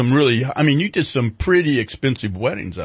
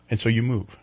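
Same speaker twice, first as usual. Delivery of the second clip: ordinary delivery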